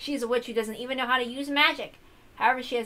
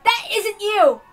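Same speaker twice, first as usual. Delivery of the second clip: higher and louder, building to a raw, pained shout; anguished shouting; 7 semitones higher, 9 dB louder than usual. Acted. Balor rejecting the new Beato, shouting in anguish. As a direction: let the hurt break out into yelling.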